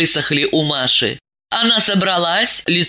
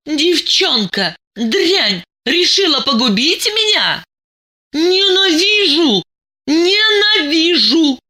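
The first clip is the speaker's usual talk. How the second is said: high, slow, hateful cry; high and slow, hating her friend; hateful outburst; 12 semitones higher, slower than usual. Acted. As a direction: spit out the hatred. Diana screams hatred at friend.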